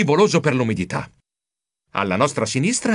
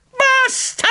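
very high cry at breaking point; exasperated shout. A narrator's reading. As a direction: scream it, unable to take more. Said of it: "Non ne potevo più e gridai". (grido esasperato)

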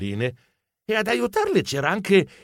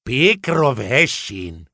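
slow, lower stunned echo; disbelief; 4 semitones lower, much slower than usual. Acted. Geronimo learns who the ballboy is, stunned disbelief. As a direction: repeat the name slowly, unable to believe it. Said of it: "Non potevo crederci".

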